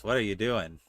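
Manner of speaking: usual speaking voice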